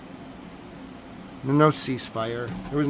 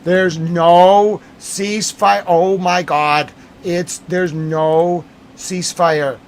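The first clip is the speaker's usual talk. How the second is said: loud, higher, slow exasperated cry; exasperated outcry; 4 semitones higher, 7 dB louder, much slower than usual. Real, not acted. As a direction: let the disbelief burst out loud. Exasperated outburst at news.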